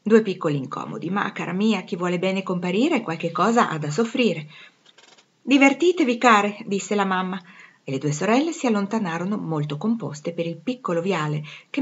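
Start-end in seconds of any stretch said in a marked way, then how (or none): 5.4-6.6 lifted, louder farewell; higher-pitched motherly farewell; motherly send-off. A narrator's reading. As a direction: call a fond goodbye to departing daughters. Mother sends daughters off.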